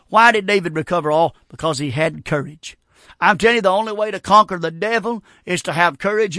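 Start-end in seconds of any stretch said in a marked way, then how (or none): none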